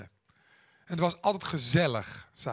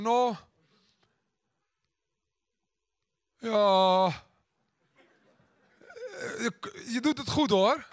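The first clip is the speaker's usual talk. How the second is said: drawn-out, louder fillers, then halting praise; stammering; 4 semitones higher, 3 dB louder, slower than usual. Retold or imitated. Grandma struggling to praise her daughter, stammering. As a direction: imitate someone haltingly forcing out a compliment. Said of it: "begon te stakkelen en te hakkelen".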